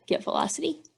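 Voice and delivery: ordinary talk, nothing marked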